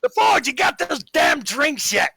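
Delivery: high, loud shout across the bar; impatient holler. Acted. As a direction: chase up the robot at full voice. Barkeep hollers impatiently at robot.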